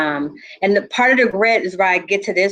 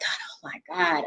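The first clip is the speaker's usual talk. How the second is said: breathless whisper, slowed; a stunned whispered oh my God; whispered shock; 3 semitones lower, 11 dB quieter, slower than usual. Retold or imitated. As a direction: whisper it to yourself in disbelief. Inner shock at mother's bedside.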